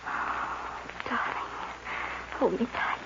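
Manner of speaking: soft whispered oh darling; cling to him in a hush; whispered plea. Acted. Girl whispering to her bandit lover at midnight. Tearful lovers meeting at night.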